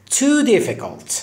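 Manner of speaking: "Too" lifted high and long, "difficult" falling; stress on too. Shown as a demonstration. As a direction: lean hard on "Too". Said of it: "stressing too". (تأکید روی too)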